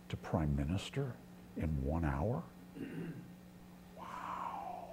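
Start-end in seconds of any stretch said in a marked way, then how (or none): 4.0-4.9 breathed-out, whispered amazement; very soft, voiceless awe; awed whisper. Real, not acted. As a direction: whisper it in wonder. Amazed at Joseph's sudden rise.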